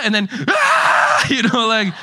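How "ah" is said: very high, louder infant screech "Ah"; baby's angry screech. Retold or imitated. Pastor mimics the baby's cry getting worse, a loud high shriek. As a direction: crank it up into a piercing baby shriek. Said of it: "he started doing this angry cry".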